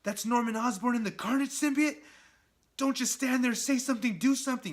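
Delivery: rising panic, pleading with himself; panicked hero. Retold or imitated. Spider-Man seeing the Carnage symbiote, high frantic panic. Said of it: "Spider-Man's thinking".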